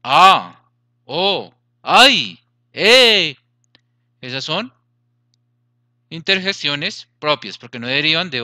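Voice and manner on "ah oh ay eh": each sound blurted out loud and emphatic; exclaimed interjections. Shown as a demonstration. Teacher demonstrating interjections to the class, loud and expressive. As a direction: act out each pure emotion as you say it. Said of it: "Por ejemplo".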